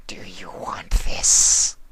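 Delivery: slow breathy whisper; a seductive hushed question; whispering changeling. Acted. Sympathy asking Twilight in a slow whisper.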